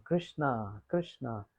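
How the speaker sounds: the parrot softly echoing Radharani's call; parrot voicing Radharani. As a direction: murmur the name lovingly like Radharani. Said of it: "exactly in the same tone as Radharani".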